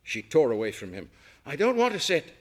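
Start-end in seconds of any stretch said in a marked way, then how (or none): 1.4-2.3 high, loud refusal; protesting. A narrator's reading. Guest refusing the chef's chair, loud and high. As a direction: push back hard against him. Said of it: "she protested".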